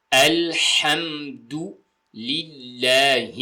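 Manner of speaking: الحمد للہ very slow, syllable by syllable; careful tajweed. Shown as a demonstration. Teacher joining the practised words for learners, careful tajweed. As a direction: draw out "al-ham-du lil-laa-hee" in الحمد للہ with pure vowels. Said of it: "یہ آپ نے ذہن میں رکھنا ہے".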